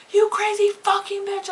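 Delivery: high furious retold yell; screaming at her. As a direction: hurl the insult at the driver, pitch way up. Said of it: "So I am screaming at this woman".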